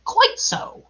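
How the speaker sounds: high-pitched little show of courage; braver still. A narrator's reading. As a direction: pipe it up high, trying to be braver. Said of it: "And then still more bravely".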